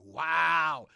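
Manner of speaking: very loud, amazed wow; big loud exclamation of amazement. Real, not acted. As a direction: burst out at full volume. Reacting to the chart.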